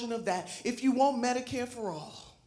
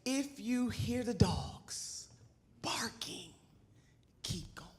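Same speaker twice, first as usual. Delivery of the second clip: hushed quote; 2 semitones lower, 9 dB quieter, slower than usual. Acted. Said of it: "She said these words".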